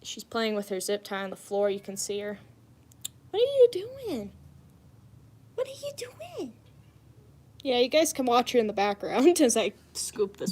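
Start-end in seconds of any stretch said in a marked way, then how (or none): 3.3-4.3 much higher "What are you doing?" aloud; high call to cat. Real, not acted. Calling to the cat. 5.5-6.5 "What are you doing?" whispered, much softer. Real, not acted. Whispering to the cat.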